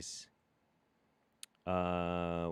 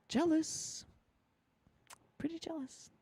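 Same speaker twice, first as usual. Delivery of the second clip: high, soft falsetto squeak; mock jealous squeak; 8 dB quieter than usual. Host admits envy of Canada in a high squeak. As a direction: squeak it like a sulky child.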